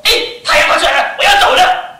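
loud, high, hurried exclamation; urgent angel. Acted. Angel pleading with Jacob to let go, loud and urgent. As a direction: call out anxiously that dawn is coming.

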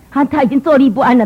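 ordinary talk, nothing marked

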